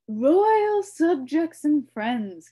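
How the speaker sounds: slow, loud, high formal king; royal address. A narrator's reading. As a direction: address the court grandly. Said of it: "continued Azaz".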